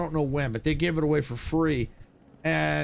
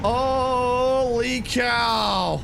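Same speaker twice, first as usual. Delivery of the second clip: very drawn-out, higher exclamation; real long cry of amazement; amazed; 5 semitones higher, much slower than usual. Real, not acted. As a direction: stretch it out in awe. Amazed by the monorail view.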